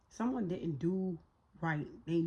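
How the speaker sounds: normal talking voice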